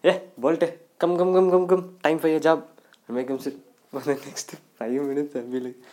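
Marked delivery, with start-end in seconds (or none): 0.1-2.6 louder, rapid-fire summons; calling the dog. Acted. Owner summoning his dog for a job, calling out. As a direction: call the dog over briskly and loudly.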